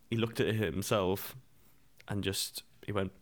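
normal talking voice

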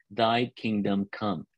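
slow and solemn, said together with the group; slow, spoken together. Shown as a demonstration. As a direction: say it along with them, slowly. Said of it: "Can you all say that with me?".

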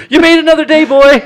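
loud, high holler to a dog; hammed-up elated yell; jubilant shout. Cousin spares his dog, acted.

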